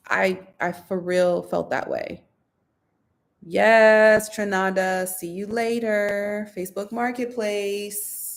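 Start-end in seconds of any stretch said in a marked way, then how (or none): none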